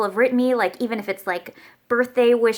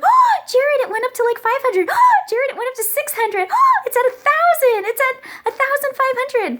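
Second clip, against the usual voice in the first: much higher, louder thrilled squealing; excited squeal; 5 dB louder than usual. Retold or imitated. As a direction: squeal each count to her husband. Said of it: "I was like".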